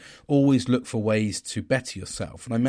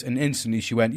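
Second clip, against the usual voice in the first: ordinary talk, nothing marked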